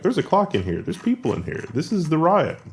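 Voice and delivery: AI spotting things. Acted. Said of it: "the A I is like".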